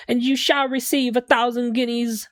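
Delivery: eccentric game defendant. Acted. Client haggling with two students over payment, pompous and high. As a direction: put on an affected London accent. Voices defendant offering money.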